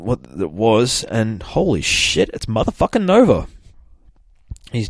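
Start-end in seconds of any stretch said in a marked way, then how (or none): 1.4-3.5 excited reveal. Real, not acted. Reviewer revealing the crashed hero to viewers, excited. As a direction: unveil the surprise with glee.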